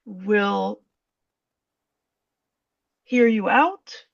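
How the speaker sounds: usual speaking voice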